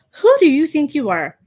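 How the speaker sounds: ordinary talk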